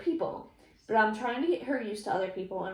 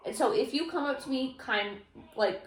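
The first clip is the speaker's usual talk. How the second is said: usual speaking voice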